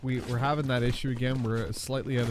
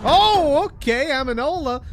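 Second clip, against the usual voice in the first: a high, loud startled "Oh"; surprised; 12 semitones higher, 7 dB louder, slower than usual. Real, not acted. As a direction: caught off guard by the shot. Surprise at a long shot.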